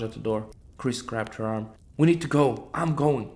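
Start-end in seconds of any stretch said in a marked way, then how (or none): 2.0-3.3 louder, higher snap; snapped. A narrator's reading. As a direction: cut him off and go. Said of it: "she snapped".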